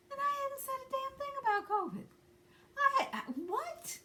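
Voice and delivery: very high, stunned disbelief; incredulous. Real, not acted. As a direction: sputter in disbelief. Reacting to the accusation.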